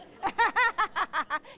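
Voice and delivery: loud real belly laugh; hearty laugh. Real, not acted. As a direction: let a big real laugh out. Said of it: "I laugh all day long".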